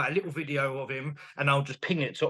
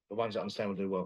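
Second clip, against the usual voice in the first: quiet repeat; 7 semitones lower, 8 dB quieter, much faster than usual. Real, not acted. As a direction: lower and softer, said again to itself.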